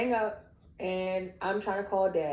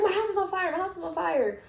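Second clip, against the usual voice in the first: panicked cry; 11 semitones higher than usual. Retold or imitated. Panicked reply to worried friends.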